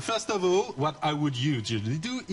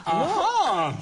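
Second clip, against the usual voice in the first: triumphant aha; 9 semitones higher, 6 dB louder than usual. Real, not acted. Chef cheers the finished roll.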